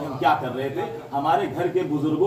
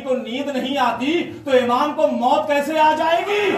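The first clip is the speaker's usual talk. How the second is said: climactic shout; 11 semitones higher, 5 dB louder, slower than usual. Acted.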